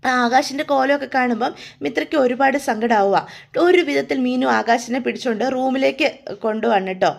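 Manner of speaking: ordinary delivery, nothing marked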